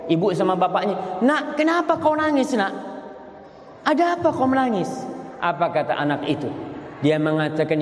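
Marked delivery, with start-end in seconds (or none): 1.2-4.9 high, anxious parent's voice; worried parents. Acted. Parents asking their crying daughter, high and worried. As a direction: ask like a worried mother.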